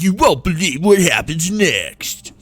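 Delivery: hyped clickbait announcer. Pretend. Gamer mocking clickbait headlines for viewers, hyped announcer voice. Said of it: "a reference to those silly clickbait articles".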